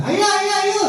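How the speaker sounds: rally the whole class to answer; bright cheerful teacher. Shown as a demonstration. Teacher calling the whole class to answer, high bright voice.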